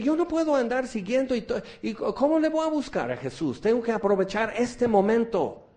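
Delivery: high, urgent voice of a blind beggar; blind Bartimeo. Retold or imitated. Blind beggar by the road calling out to Jesus, high and urgent. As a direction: blurt it out before the chance passes. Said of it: "Bartimeo dice".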